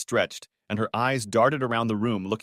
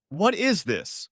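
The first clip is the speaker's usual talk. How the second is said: shouted; 8 semitones higher, slower than usual. A narrator's reading.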